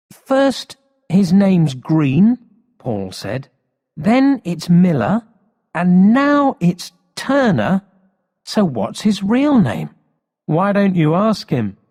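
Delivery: put-on indignant boy. Paul complaining to the police chief, high and indignant. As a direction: spell out the lies like an outraged schoolboy.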